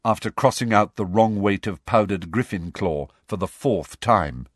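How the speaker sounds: usual speaking voice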